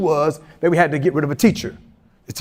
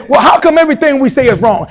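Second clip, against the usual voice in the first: exasperated mom; 5 semitones higher, 4 dB louder than usual. Retold or imitated.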